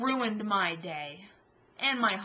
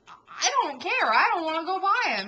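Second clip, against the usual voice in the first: louder, rushing a frightened refusal to her husband; scared protest; 7 semitones higher, 8 dB louder than usual. Retold or imitated. Telling Matt she won't go past the scooter man, loud and scared. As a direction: plead not to go near the scary man. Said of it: "And I'm like".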